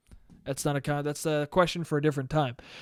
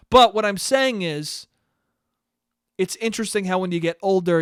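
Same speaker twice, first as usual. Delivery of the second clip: ordinary talk, nothing marked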